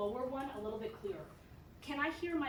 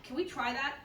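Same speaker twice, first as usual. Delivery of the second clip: ordinary delivery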